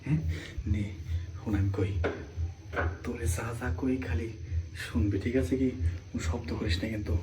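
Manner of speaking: soft sly murmur; hushed coaxing. Acted. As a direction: coax quietly so no one hears. Coaxing sister-in-law in secret.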